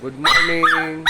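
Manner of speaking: high, loud greeting on the pet's behalf; speaking for the dog. Pretend. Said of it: "Ano sasabihin mo?".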